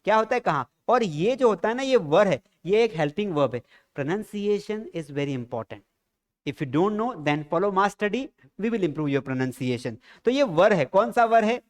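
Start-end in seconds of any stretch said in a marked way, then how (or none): 4.0-10.0 slow, lowered English pitch for the channel; teacher's slow ad line in English; slow promo line. Acted. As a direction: sell the lesson slowly and seriously.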